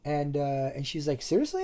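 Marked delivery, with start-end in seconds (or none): 1.2-1.6 much higher surprised rise; surprised question. Retold or imitated. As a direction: ask it in happy disbelief. Girl surprised.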